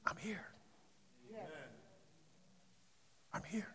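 soft, breathy whisper as the still small voice; whispered divine voice. Acted. Pastor whispers God's reply to Elijah. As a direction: barely breathe the words.

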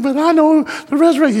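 high imitated lament; mock wailing. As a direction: keep up the sister's hammed-up wail. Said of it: "and she's wailing".

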